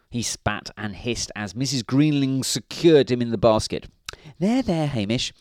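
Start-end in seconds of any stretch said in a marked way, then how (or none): 4.4-5.3 high, soothing wifely voice; sing-song motherly reassurance; consoling. A narrator's reading. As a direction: comfort the cat in a woman's voice. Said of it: "said Missus Grinling consolingly".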